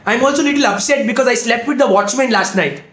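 usual speaking voice

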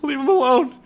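high, loud mock plea; mock distress. Gamer pleading with the game, high and hammed-up. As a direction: beg the game to spare the car.

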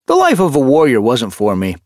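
high, loud, cheery declaration; quite cheerful. A narrator's reading. Former warrior explains his kittypet life, quite cheerful. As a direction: declare it brightly and without regret. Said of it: "Hutch declared quite cheerfully".

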